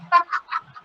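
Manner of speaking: squealing giggle; breathless high laugh; high-pitched laugh. Real, not acted. Laughing at own impression.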